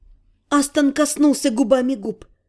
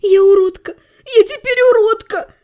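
high, louder cry of anguish; despairing wail; 7 semitones higher, 4 dB louder than usual. A narrator's reading. Heroine weeps over her marked face, despairing high wail. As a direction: wail it out, pitch climbing.

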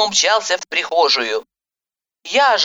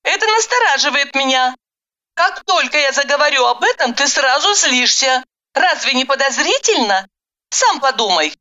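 higher, insistent woman's voice; voiced heroine arguing; 7 semitones higher than usual. A narrator's reading. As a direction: press the point in a higher voice. Heroine argues. (настойчивый женский голос)

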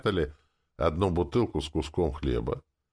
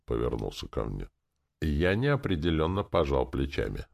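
usual speaking voice

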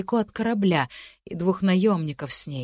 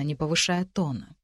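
plain reading voice

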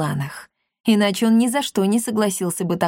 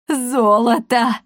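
slow, higher exclamation of amazement; shocked cry; 4 semitones higher, 4 dB louder, much slower than usual. A narrator's reading. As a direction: cry out, stunned, drawing the word out. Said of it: "потрясённо закричала Коптильда". (потрясённый возглас)

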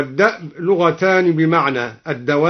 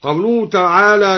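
ordinary talk, nothing marked

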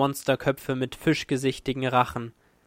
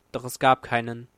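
ordinary delivery, nothing marked

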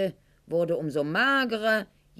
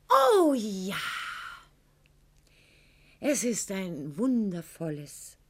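mock rapture; 2 semitones higher than usual. Mocking rapture about poet's love.

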